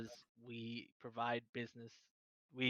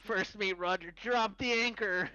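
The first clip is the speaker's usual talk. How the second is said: mock pirate captain; 7 semitones higher, 3 dB louder than usual. Giving orders to first mate Roger, mock pirate captain. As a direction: bark orders like a ship's captain.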